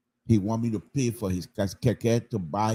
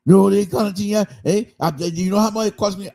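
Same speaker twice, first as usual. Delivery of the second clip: whining keke driver; 8 semitones higher, 4 dB louder than usual. Retold or imitated.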